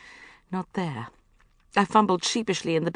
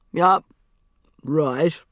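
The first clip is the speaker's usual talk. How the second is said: obedient; 7 dB louder than usual. A narrator's reading.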